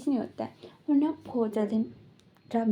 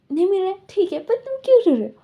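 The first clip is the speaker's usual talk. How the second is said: higher, louder shocked outburst; startled by the crying, raise your voice; 7 semitones higher, 8 dB louder, faster than usual. Retold or imitated. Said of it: "वांगचिंग shock". (चौंक कर ऊँची, तेज़ आवाज़ में)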